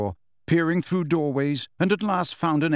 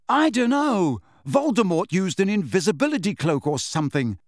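high, exasperated raised voice; shouting; 7 semitones higher than usual. A narrator's reading. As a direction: shout back in an argument, pitched up. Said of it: "Harry shouted".

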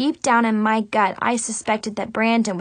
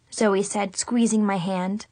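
plain reading voice

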